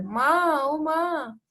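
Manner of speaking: mildly acted moan of pain; loud groaning. Mohan groans to his mother with a stomach ache, mock loud moaning. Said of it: "Mohan जोर से कराकर".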